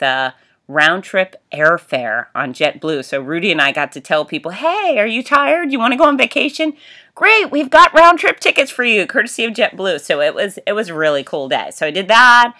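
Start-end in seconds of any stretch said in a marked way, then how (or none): 4.5-10.0 high, bright announcer pitch; hammed-up hype voice; excited announcer. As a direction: sell the free tickets like a game show host. Announcer hyping prizes to runners.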